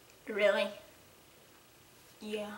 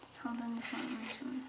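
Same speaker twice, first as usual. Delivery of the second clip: whisper; 2 semitones lower, 20 dB quieter, much faster than usual. Real, not acted. Mumbling while reading a letter.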